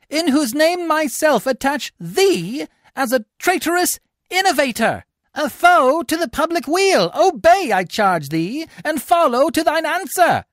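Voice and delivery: high, loud formal charge; stern tribune. Acted. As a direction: charge him as a traitor. Tribune arrests Coriolanus.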